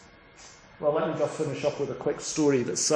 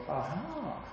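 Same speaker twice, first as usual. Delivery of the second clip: a hushed, sinister devil, much softer; devilish whisper; 4 semitones lower, 15 dB quieter than usual. Retold or imitated. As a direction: hiss it like a scheming devil. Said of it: "I thought".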